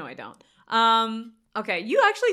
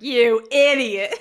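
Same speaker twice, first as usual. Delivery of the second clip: mock condescending; 9 dB louder, slower than usual. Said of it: "Google was like".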